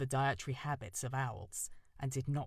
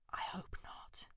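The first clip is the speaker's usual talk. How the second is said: slow, soft frightened whisper; 6 dB quieter, slower than usual. Acted. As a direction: breathe it out scared and unvoiced. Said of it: "She whispered back".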